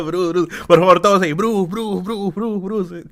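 coro de Matilda. Pretend.

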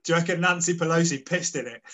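usual speaking voice

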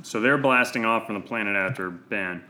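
ordinary talk

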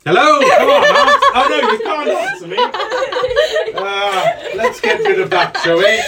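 high, loud, laughing wizard. Acted. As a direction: tease the frozen guest. Wizard addressing frozen guest.

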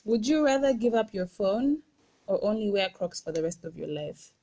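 normal talking voice

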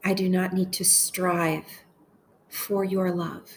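slow, measured meditation voice with long pauses; unhurried, deliberate phrases for listeners to echo; guided prayer meditation. Acted. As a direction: pray each short phrase slowly, leaving space to repeat it.

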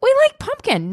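very high voice of approving friends; high mock chorus of approval; friend group's reaction. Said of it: "and everyone's like".

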